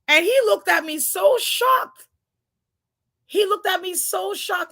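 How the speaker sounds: ordinary talk, nothing marked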